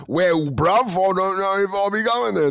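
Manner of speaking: imitating a fake British accent; mock British accent. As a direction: play a sudden fake Brit. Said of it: "If someone's just like".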